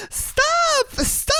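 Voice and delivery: high shrieking stop; urgent shout. Retold or imitated. Friend shrieks at the attacker to stop the fight, high and urgent. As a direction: yell it to break up the fight.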